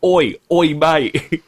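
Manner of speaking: high, loud hammed-up Cockney; cheeky London geezer voice; mock Cockney accent. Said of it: "the accent, the American actors doing".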